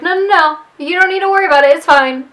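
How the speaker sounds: high, loud, fast flustered no-no-no; panicked denial. Retold or imitated. Billy blocks Jessica from the kitchen, panicked. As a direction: rush to stop her from going.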